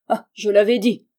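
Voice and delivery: loud outburst on the Ah; exclaiming. A narrator's reading. Holmes at the trail by the laurels, exclaiming. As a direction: burst out loudly, proved right.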